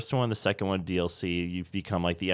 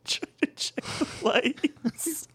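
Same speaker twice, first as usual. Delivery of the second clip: laughing squeaky echo; 4 dB quieter, much slower than usual. Pretend.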